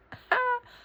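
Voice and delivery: short, loud, high burst of laughter; sudden high cackle. Real, not acted. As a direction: let out one high "ha". Laughs at own remark.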